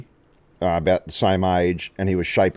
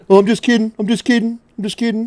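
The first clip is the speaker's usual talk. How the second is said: mock girly backpedal. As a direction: squeal it like a caught-out girl.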